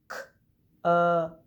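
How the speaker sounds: k, up said apart, loud on up; segmented sounds. Shown as a demonstration. Teacher blending words for the class, sound by sound. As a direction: split k and up clearly. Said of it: "I'm gonna blend some words".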